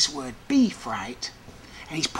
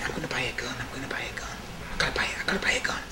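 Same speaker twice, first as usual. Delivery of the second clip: low anxious whisper, speeding up at the end; hushed whisper; 4 semitones lower, 5 dB quieter, much faster than usual. Retold or imitated. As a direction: whisper the thought to yourself, urgent. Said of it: "I remember thinking".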